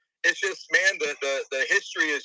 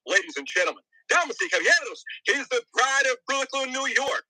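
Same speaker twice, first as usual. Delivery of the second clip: boxing ring announcer; 6 semitones higher than usual. Pretend. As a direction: build it up like a fight night MC. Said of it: "you gotta come in correct".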